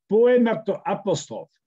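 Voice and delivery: high, ringing title announcement, word by word; declaimed title. Acted. Poet presenting the poem to online friends, declaiming. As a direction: announce the poem with stagey pauses. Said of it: "nous allons vous le déclamer".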